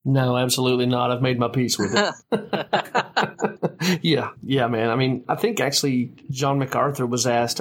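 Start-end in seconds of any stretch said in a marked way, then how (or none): 2.2-4.0 long, high-pitched chuckling laugh; real laugh at the co-host's joke; hearty laugh. Real, not acted. Laughs at co-host's joking answer.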